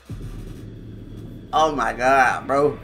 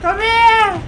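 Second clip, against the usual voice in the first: calling out; 9 semitones higher than usual. Real, not acted. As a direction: shout after someone running off. Calling after fleeing victim in game.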